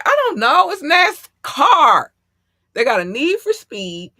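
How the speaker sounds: high, loud, slow exclaiming; excited outburst; excited shout. Real, not acted. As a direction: shout it out with glee. Excited exclamation about nascar.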